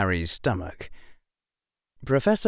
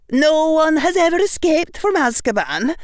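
high firm witch voice; stern witch stating fact; 6 dB louder than usual. Acted. McGonagall voiced.

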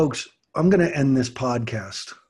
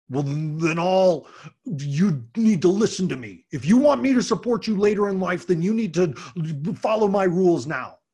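lecturing dad; 4 semitones higher, 4 dB louder than usual. Retold or imitated.

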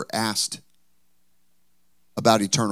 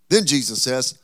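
ordinary talk

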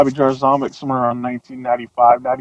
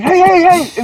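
alarmed retold shout. Fearing attack, calling singer off.

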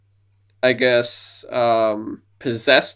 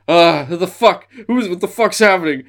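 higher, louder startled grumble; confused monster; 5 semitones higher, 5 dB louder than usual. Retold or imitated. Godzilla sprayed with webbing, startled higher louder grumble. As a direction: sputter like a monster hit in the face.

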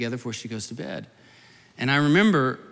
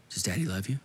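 low, soft fatherly question; tender reassurance; 4 semitones lower, 5 dB quieter than usual. Retold or imitated. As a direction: gently coax an answer from a frightened child. Father soothing frightened daughter at night.

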